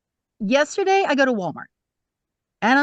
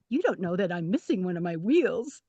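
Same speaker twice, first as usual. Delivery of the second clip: inner thought; 5 semitones lower, 6 dB quieter than usual. Retold or imitated.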